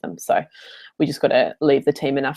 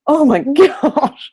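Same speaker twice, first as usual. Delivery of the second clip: laughing disbelief; 10 semitones higher, 5 dB louder, much slower than usual. Retold or imitated. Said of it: "and I was just like".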